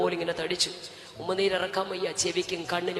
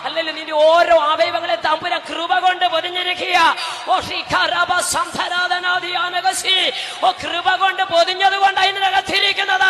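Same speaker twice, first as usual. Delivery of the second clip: loud, higher, building to a shouting climax; fiery preaching; 11 semitones higher, 14 dB louder than usual. Acted. Pastor to congregation about grace, building fiery preaching shout. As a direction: preach it like thunder from the pulpit, rising to a roar.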